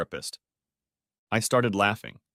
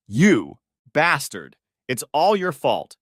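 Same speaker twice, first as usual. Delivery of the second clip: slow, loud furious accusation; mild acted scream, drawn out; angry scream; 4 semitones higher, 5 dB louder, slower than usual. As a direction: hurl the blame at him. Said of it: "screamed I".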